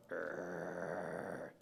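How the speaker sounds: low drawn-out growl of a small dog. Retold or imitated. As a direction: imitate a dog's steady growl. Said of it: "he just started growling, just going".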